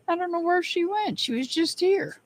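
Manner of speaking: playful singsong. Real, not acted. Playful aside about missing viewer.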